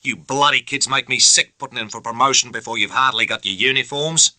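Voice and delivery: disgusted officer berating a junior; contemptuous tirade. A narrator's reading. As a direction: rail at the upstart. Bennett's continuing tirade.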